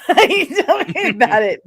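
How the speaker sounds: words broken up by laughter; laughing retelling. Retold or imitated. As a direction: quote yourself and crack up laughing. Retells own remark while laughing.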